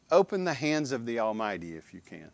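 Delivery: normal talking voice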